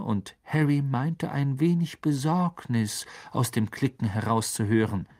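usual speaking voice

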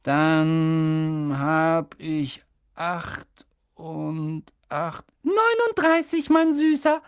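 lightly voiced Dudley, counting slowly. A narrator's reading. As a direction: drag out the count like a spoiled kid. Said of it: "sagte er langsam".

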